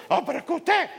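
high, loud retold wife protest; a hint of the wife nagging; mock nagging wife. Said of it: "me van regañando las dos". (esposa que reclama, aguda)

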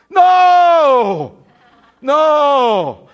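long, high, loud NO; emphatic shouted no. Real, not acted. Preacher rejecting his own wish before the congregation, emphatic shout. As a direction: bellow a drawn-out refusal.

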